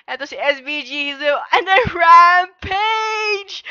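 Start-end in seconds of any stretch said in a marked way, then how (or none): 1.5-3.6 slow, high, loud drawn-out shout; fighting game announcer. Acted. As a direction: bellow the kill streak. Caster calls the rampage.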